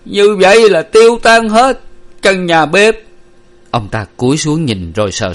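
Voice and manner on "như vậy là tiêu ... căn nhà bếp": high, loud alarmed shout; panicked cry. A narrator's reading. Father sees the kitchen burning, panicked cry. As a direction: cry out in horror. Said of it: "ông hốt hoảng la lên".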